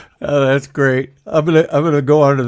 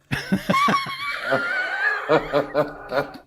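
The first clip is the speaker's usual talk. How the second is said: helpless real laughter; 7 dB quieter than usual. Real, not acted. Cartoonist cracking up at his own cartoons, real laughter. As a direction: crack up laughing for several seconds. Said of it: "I'm laughing again".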